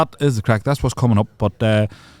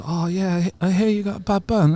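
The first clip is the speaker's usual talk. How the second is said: high-pitched mock English girl voice; 9 semitones higher than usual. Retelling girls approaching about the burn, high mock English girls. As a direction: put on a high, girly English voice. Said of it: "a group of these English girls come up and goes".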